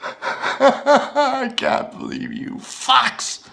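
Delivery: high, slow laughing shout; crazed laughing. Acted. Mocking the captors, crazed laughing. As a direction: laugh it out madly.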